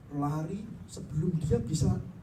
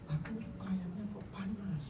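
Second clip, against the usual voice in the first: weak whisper; 4 semitones higher, 6 dB quieter than usual. Retold or imitated. Weak husband answers wife.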